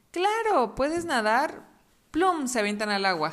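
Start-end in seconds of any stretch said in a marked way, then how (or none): 0.1-1.7 high, eager cub voice; little fox voice. Acted. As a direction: squeak it like a baby fox. Voz del zorrito. (voz muy aguda de zorrito)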